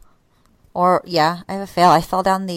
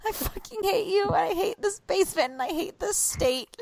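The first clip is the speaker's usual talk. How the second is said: high, strained mock wail while falling; retold yelling in pain; 11 semitones higher, 7 dB quieter than usual. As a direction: cry out at the stairs, pitched up high. Retold cry while falling downstairs.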